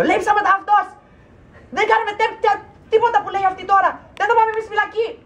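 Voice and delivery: high-pitched, rapid Greek tirade; mock angry outburst. Woman bursting out in Greek at the police, mock outrage. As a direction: explode in hammed-up indignation, racing through it.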